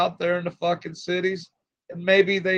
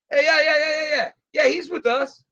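excited mock greeting; 10 semitones higher than usual. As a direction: shout it like gang members greeting one of their own. Retold gang greeting the recruiter.

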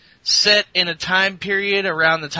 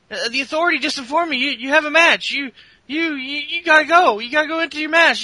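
much higher and fast, stammering; nervous backstage interviewer babbling; flustered interviewer; 11 semitones higher, 3 dB louder, faster than usual. Retold or imitated. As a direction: hurry him out the door in a panic.

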